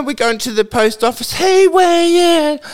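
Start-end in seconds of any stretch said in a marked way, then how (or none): 1.3-2.6 loud, high cheery greeting across the street; friendly call-out. Retold or imitated. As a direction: call his name out happily and loudly. Townsfolk greet him loudly.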